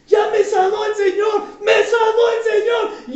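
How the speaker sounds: high, loud cry of joy, slowing at the end; joyful shout. Retold or imitated. Healed woman shouting to the preacher, loud and joyful. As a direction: shout it out like you were just healed.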